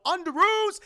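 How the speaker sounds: high, loud holler; called-out summons. Retold or imitated. Imitating Tony Stark calling Spider-Man in, loud holler. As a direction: yell it as a cue across a distance.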